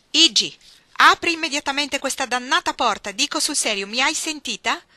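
loud, high, insistent order; stern angry demand. Acted. Ruth to Iggy through the locked door, angry demand. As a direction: demand to be obeyed at once.

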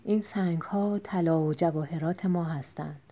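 softly, so the thieves outside can't hear; a hushed word to his wife, kept low; quiet aside. Acted. Tinal quietly shows his wife the stones, hushed. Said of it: "آهسته گفت".

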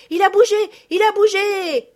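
loud, high, excited shouts; joyful cry. A narrator's reading. Four girls seeing the bird move, joyful cry. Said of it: "s'écrièrent ensemble les quatre petites".

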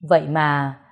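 ordinary delivery, nothing marked

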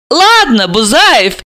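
high-pitched lively character voice; Alisa. Acted.

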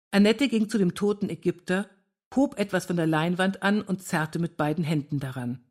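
ordinary delivery, nothing marked

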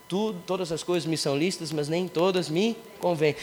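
scripture reading. Shown as a demonstration. Reading Bible verse.

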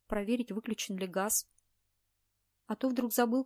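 usual speaking voice

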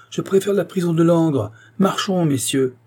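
high, emphatic, defiant outcry; exclaimed. A narrator's reading. Prisoner choosing prison to the gendarmes, defiant exclamation. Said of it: "s'écria Santenoge".

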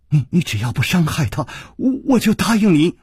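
stammered, reluctant promise climbing high; halting desperate bargain; through gritted teeth. A narrator's reading. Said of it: "柳无眉咬了咬牙说".

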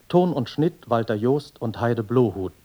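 ordinary talk

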